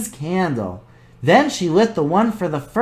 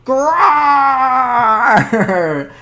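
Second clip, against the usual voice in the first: bear growl; 5 semitones higher than usual. Acted.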